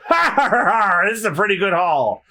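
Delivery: high, laughing over loot; high hammed-up pirate chuckle; gloating laugh. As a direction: cackle over the haul.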